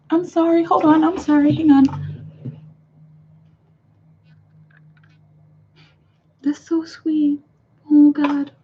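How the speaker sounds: real emotion, voice rising high and thinning out; high, tearful and halting; choked up. Real, not acted. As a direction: overcome by something sweet, fighting back tears. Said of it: "got, like, really emotional".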